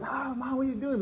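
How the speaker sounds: high-pitched imitation of distressed children; upset kids. Kids to their mother in the car, mimicked high and upset. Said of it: "the kids were like".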